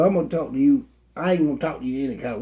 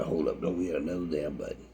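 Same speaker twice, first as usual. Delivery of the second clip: very low, hushed warning; restrained warning; 13 semitones lower, 13 dB quieter than usual. Retold or imitated. Pastor stopping a member at the machine, restrained warning. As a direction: drop right down low and soft.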